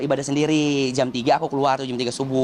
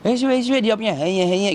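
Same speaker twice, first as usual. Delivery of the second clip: put-on old woman's words; 7 semitones higher, slower than usual. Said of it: "aku ajak ngobrol".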